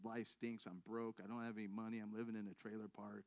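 low, quiet admission of misery; retold dejection. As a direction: recount it glumly, low and soft. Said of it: "you seem kinda down".

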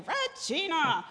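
high, drawn-out preacher's call; Mark Dunphy. Retold or imitated. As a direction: stretch the name out high, mimicking him. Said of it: "You know how he talks".

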